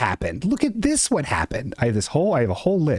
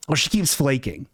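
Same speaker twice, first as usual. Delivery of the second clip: ordinary talk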